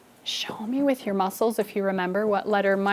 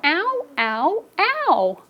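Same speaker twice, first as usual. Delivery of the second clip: high, yelping cries of pain; hurt letter O; 9 semitones higher, much slower than usual. Acted. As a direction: yelp like a pinched little kid. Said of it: "until letter O said".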